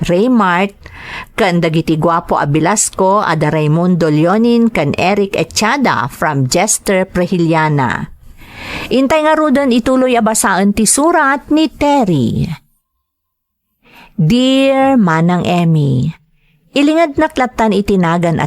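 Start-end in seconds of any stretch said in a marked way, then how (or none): none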